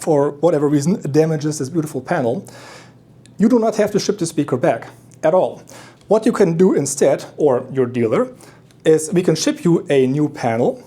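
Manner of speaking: usual speaking voice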